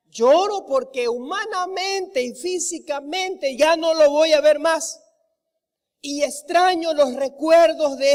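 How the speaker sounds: impassioned sermon delivery, hammering the repeats; fervent preaching. Acted. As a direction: drive each line home to the congregation. Preaching at full force.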